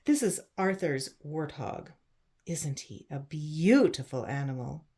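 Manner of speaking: plain reading voice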